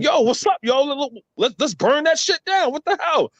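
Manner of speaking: high, agitated egging-on; retold riled-up rallying. Northerner riling people up over a flag, high and indignant. Acts out riling up his friends.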